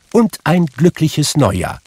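high, spirited farewell; undaunted, cheery nephew; cheerful well-wishing. A narrator's reading. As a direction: add a merry parting wish. Nephew's cheerful parting wish.

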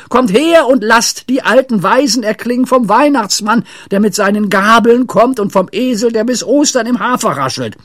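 high, loud declaiming of carol words; Pippi shouting. A narrator's reading. Pippi belting out muddled carol words, shouting. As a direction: keep it high and loud all through. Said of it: "schrie Pippi".

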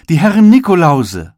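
high, loud admiring call; the whole shop marvels at them; delighted announcement. A narrator's reading. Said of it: "kam es von allen Seiten". (staunend und laut ausgerufen)

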